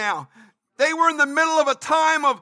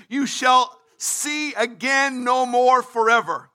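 ordinary talk, nothing marked

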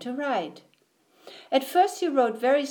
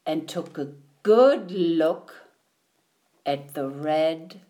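slow right down and let the last word drop to a hush; knowing story ending; 6 semitones lower, slower than usual. A narrator's reading. The narrator hints at Duck's next ride, slowly and knowingly. Sly closing hint of story.

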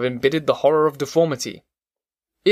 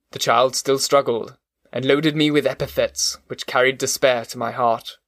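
ordinary delivery, nothing marked

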